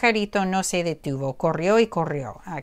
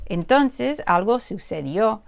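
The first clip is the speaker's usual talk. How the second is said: ordinary delivery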